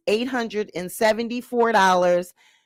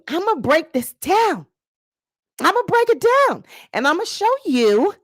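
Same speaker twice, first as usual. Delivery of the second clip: hyped up; 8 semitones higher, 4 dB louder than usual. Real, not acted. Hyping up the breakdown.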